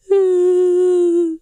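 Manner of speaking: high, wet laugh breaking into a sob; crying laugh, loud and high; tearful laugh-sob. Real, not acted. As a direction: let a real sob-laugh burst out. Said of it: "I'm crying".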